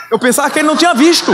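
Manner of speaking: normal talking voice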